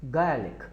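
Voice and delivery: गार्लिक said as "gelik" without the r; गार्लिक like a British speaker, no r; English way, no r. Shown as a demonstration. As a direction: drop the r in गार्लिक. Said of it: "आर नहीं बोलना है".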